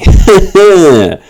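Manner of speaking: real burst of laughter, high and loud; hearty laugh. Real, not acted. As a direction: cracks up. Laughs at his jinx.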